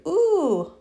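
high, sharp ooh of a stiff neck; pained ooh. Real, not acted. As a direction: wince at the stretch. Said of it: "there is some tension in there".

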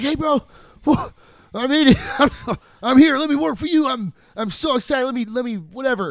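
high, loud and bursting with eagerness; eager excited. Retold or imitated. Eager young guy arriving at Edison's door, excitedly. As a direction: pitch yourself like a fan meeting his idol.

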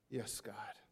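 soft breathless whisper; hushed whisper; whispered prayer. Pretend. Whispered prayer in quoted reply.

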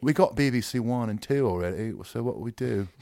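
mock British accent. Co-host as radio executive names BBC Three, British accent.

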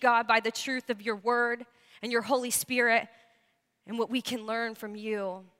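low, unhurried congregational prayer. Real, not acted. Thanking God with the church before the message, low earnest prayer. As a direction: lead them gently in prayer, pitched low.

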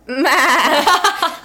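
loud, high imitated baa; sheep bleat. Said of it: "looked at me and goes".

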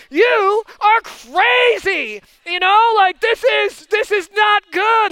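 loud, high, drawn-out protest; hammed-up husband scolding; exasperated outburst. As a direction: stretch the words in disbelief at the driver. Retold scolding of wife.

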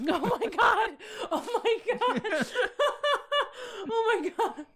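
helpless high laughter; cracking up, barely getting words out; wheezing with laughter at the story; laughing hard. Real, not acted.

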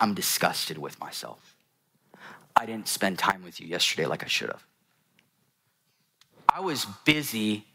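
low, soft imitation of a downcast daughter; retold tearful confession. Daughter confesses to her dad, mildly tearful retelling. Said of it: "and she's crying, and she's like".

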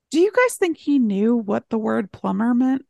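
usual speaking voice

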